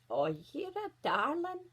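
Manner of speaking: slow, high granny voice; old granny. A narrator's reading. Granny thanks her visitor at the door, old voice. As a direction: coo slowly as an old granny. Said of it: "Granny said".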